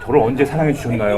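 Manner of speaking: soft, polite question; polite asking. Shown as a demonstration. Preacher showing how to ask God politely, softly. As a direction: ask gently and humbly. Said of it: "공손히 물어봐야".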